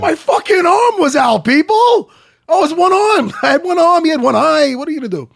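hammed-up loud, high-pitched complaint; indignant mock protest. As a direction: holler it like an aggrieved fighter making excuses. Acts out fighter's indignant excuse.

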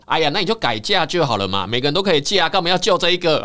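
mocking beggar. Filthy beggar mocking the wife's pleas, loud sneering taunt. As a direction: jeer at her scornfully. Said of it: "一直侮辱他".

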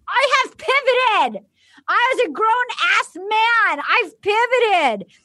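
high, slow mock guy insisting; mock Jason. Cohost mocking Jason's excuse, high and drawn out. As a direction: stretch it out, playing the self-serious bachelor.

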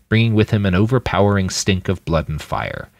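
usual speaking voice

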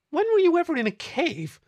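high, loud disbelieving question; can't believe he was in a cave; incredulous. A narrator's reading.

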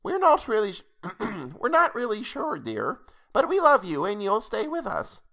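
put-on high, thin old grandma; grandmother voice. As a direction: play a sweet old grandmother. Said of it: "what the fuck kind of grandmother voice this is".

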